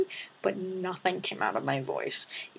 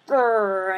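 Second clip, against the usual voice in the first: short playful growl; mock growl; 7 dB louder than usual. Friends growling while swatting bugs, mock growl. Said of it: "being like".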